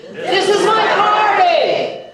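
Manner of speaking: high, loud and slow group yell. Pretend. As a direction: belt it out like a rally chant. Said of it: "scream out".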